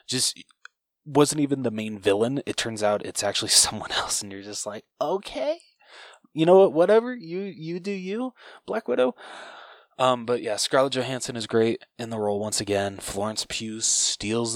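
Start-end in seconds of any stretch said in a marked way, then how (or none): 5.0-9.1 high-pitched, sing-along "okay, whatever"; mock dismissive concession. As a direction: act out a baffled shrug, pitched well up. Mock reaction to plot twist.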